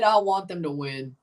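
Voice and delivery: ordinary talk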